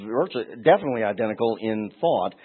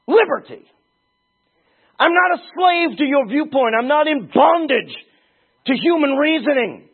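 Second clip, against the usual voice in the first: preaching at full force; 10 semitones higher, 6 dB louder than usual. Acted. Preacher rebuking those who think Jesus insane, shouting. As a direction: thunder it at the crowd.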